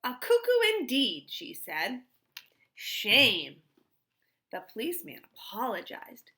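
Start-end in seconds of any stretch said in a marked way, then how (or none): 0.0-1.3 indignant, loud and higher; angrily. A narrator's reading. Mother scolding the policeman, indignant and loud. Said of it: "she turned angrily". 2.7-3.6 loud stern rebuke; scolding. A narrator's reading. Mother rebuking the policeman, loud and stern.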